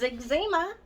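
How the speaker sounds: "eczema" as eg-ZEE-muh, high-pitched; old-time pronunciation. Shown as a demonstration. Vlogger declaring that's what it is, high eg-ZEE-muh for "eczema". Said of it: "That's what it is".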